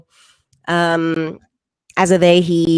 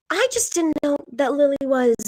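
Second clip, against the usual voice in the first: higher, hesitant mother voice; imitating mom; 7 semitones higher than usual. Mother working up to a confession, higher and halting. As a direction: mom trailing off before the big word. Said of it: "And she says".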